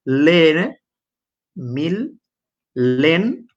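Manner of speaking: "মিল" and "লেন" said apart with pauses, the doubled letters sounded; syllable by syllable. Shown as a demonstration. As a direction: break the word into spelt syllables. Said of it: "আমি মিলেনিয়ামকে বলি মিল".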